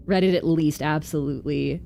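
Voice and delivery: ordinary delivery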